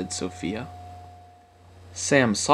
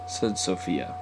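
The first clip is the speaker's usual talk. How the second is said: plain reading voice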